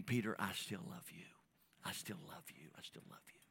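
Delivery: soft and tender, fading to a whisper. Retold or imitated. Jesus telling Peter he still loves him, fading tender whisper. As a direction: forgive him gently, dropping away to nothing.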